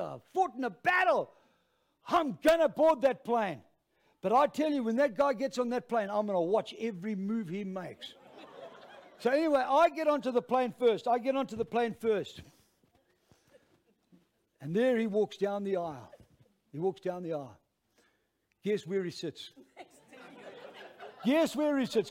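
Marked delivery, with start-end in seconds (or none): 0.0-3.6 loud, high battle-cry bravado; mock-heroic self pep talk; rallying himself. Rallies himself as a soldier. 21.2-22.1 loud, high-pitched challenge to the crowd; urgent, punched-up question; insistent prodding. Real, not acted. Asks again, prodding the audience.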